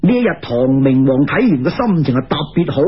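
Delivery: plain reading voice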